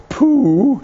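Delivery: Piglet voice. Acted. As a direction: call his friend's name as tiny Piglet. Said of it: "he whis".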